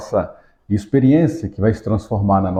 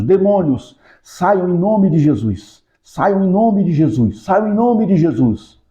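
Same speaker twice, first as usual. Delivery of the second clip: slow, higher, rising commanding rebuke; mock exorcism command; 6 semitones higher, slower than usual. Shown as a demonstration. Teacher demonstrating rebuking demons, slow commanding voice. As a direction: cast out demons with growing authority. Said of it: "É a entonação da minha fala?".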